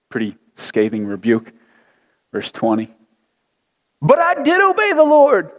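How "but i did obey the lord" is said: put-on high whining child's protest; whiny kid. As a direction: whine it like a caught kid. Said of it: "your kid's best whiny voice".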